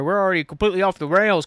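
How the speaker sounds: ordinary talk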